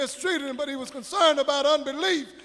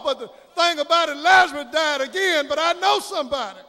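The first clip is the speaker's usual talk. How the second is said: ordinary talk, nothing marked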